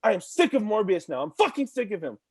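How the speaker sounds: normal talking voice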